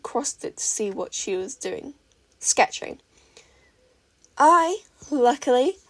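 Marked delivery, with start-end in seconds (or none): 4.4-5.8 loud, slow, gloating; smug tease. A narrator's reading. Mei teasing Izuku about clients, smug. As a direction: lean into luckily.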